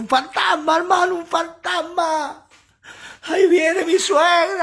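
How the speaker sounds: high, loud, crying shriek of panic; hammed-up terrified wail; terrified scream. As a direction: shriek like you've seen a ghost. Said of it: "No creo que gritaban así".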